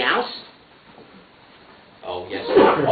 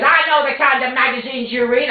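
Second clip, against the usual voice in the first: accusing shout; 9 semitones higher, 3 dB louder than usual. Acted.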